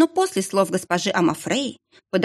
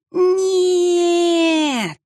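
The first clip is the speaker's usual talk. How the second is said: wailing howl; 12 semitones higher, 4 dB louder than usual. Acted. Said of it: "выла она на одной тоскливой жуткой ноте".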